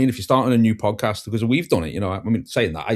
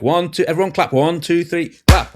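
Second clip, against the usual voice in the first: high called-out count, louder; calling out count; 7 semitones higher, 4 dB louder than usual. Retold or imitated. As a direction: call the count to the group. Counting in a group clap.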